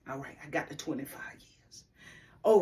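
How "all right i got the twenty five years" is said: whispered resignation. Real, not acted. Recalls her sentence.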